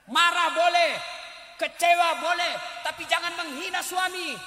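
loud warning; forceful preaching. Real, not acted. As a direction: proclaim it to the hall. (seruan keras penceramah)

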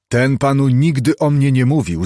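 a lightly acted angry outburst; angry cry. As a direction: snap back in mild anger. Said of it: "zawołał gniewnie Hyde".

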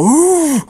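high, loud "ooh" of blown air; deep-breath blowing. Shown as a demonstration. Player told to start blowing in hard, big high blowing sound. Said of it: "deep breath and go".

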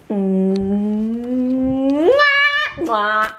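long hummed wind-up, then "mwah"; exaggerated kiss. Woman giving a beard kiss, exaggerated kiss sound. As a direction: hum slowly into a playful smack.

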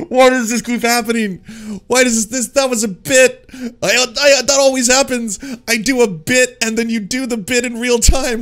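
high, loud, laughing through every word; cracking up, can't believe what he just heard; laughing in disbelief. Real, not acted. Host reacting to the guest doing the bit for real, laughing high and loud.

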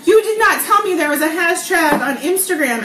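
playful indignation at the news; surprised. Real, not acted. Discovers hashtag friend never mentioned.

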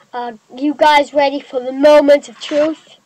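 loud, eager countdown; excited countdown. Real, not acted. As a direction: build it up, loud. Countdown to the reveal.

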